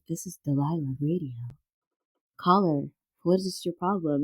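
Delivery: lightly voiced late-night radio host; a hint of low call-in host; Delilah. Host greeting a late-night caller, lightly put-on radio voice. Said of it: "take phone calls, like, late at night".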